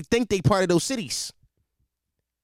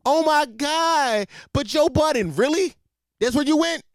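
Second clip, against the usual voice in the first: mock disbelief; 7 semitones higher than usual. Mock dismay at [name].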